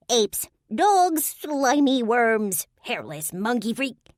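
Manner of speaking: high, loud, slow string of insults; high fairy insults. A narrator's reading. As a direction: spit out insults like a nasty sprite.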